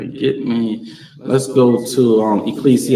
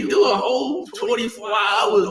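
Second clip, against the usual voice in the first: preaching shout; 10 semitones higher than usual. Real, not acted. Preacher urging full fast.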